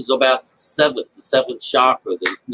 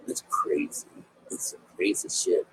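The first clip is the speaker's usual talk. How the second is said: hushed, breathy whisper under the breath; whispered aside; 8 semitones lower, 11 dB quieter than usual. Real, not acted. To the listeners about the Bible story, a whispered aside. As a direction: drop to a whisper as if sharing a secret.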